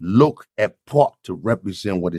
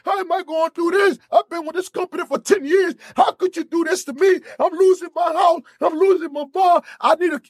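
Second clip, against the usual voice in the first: panicked shout. Retold or imitated. Laid-off worker panicking over his kids and house, shouting. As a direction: yell it like you're losing everything. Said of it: "they panicked and lose their mind".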